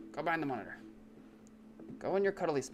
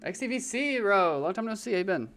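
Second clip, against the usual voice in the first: high, bright welcome; excited greeting; 7 semitones higher, 5 dB louder than usual. Real, not acted. Streamer greeting a returning viewer, excited.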